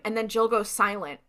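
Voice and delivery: usual speaking voice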